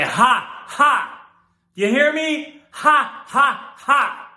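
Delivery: mocking shout. Mocking the woman.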